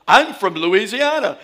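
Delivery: put-on trying hard to hide the Louisiana accent; over-careful Louisiana speaker, giggling; careful diction, laughing. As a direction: Louisiana accent held back with great effort. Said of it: "trying to be so good with my diction".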